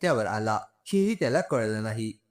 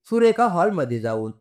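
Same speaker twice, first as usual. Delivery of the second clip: usual speaking voice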